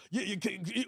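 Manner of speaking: usual speaking voice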